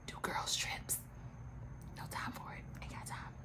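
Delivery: very soft confidential whisper; hushed, voiceless admission; whispered aside. Real, not acted. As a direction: whisper it under your breath. Confidential aside about girls' trips.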